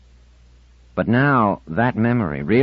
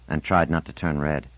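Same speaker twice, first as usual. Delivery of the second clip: ordinary delivery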